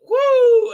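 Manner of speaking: very high, loud swooping woo; whoosh whoop. Retold or imitated. Reviewer imitating the magic leap onto the boat, high loud whoop.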